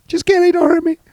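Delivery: tiny, very high mock-scared plea; high-pitched playful begging; mock pleading. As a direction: squeal it in pretend fear, taking back the bravado. Mock plea after insulting Dino.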